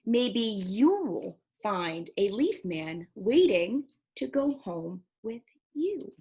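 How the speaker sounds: put-on lively storybook narration for kids; reading to children. A narrator's reading. Teacher finishing Leaf Man for the class. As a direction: read it with gentle wonder.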